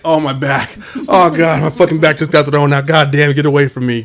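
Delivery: playful pained griping; mock aching groaning. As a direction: grumble as if your back just went out. Acts out feeling old, back out.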